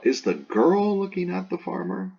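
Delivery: put-on "girl" lifted high, high tone kept through "farmer"; stress on GIRL. Shown as a demonstration. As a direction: ask it with the weight on "girl". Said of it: "where the emphasis is on the girl".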